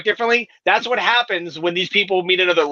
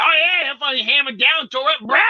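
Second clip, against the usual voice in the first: put-on higher, rowdy Maine accent; 7 semitones higher than usual. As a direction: brag like a local Maine guy. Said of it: "in this tone and accent".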